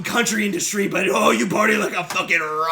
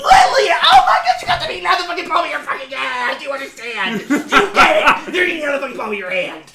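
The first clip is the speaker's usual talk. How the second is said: high, loud gushing praise; airy gushing darling tone; 7 semitones higher, 8 dB louder than usual. Acted. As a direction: gush over him like a starstruck fan. Manager gushes over star.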